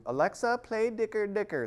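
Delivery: put-on high, loud toddler voice; three-year-old child. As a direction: imitate a little kid calling out a request. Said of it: "This cute little three-year-old who could barely talk".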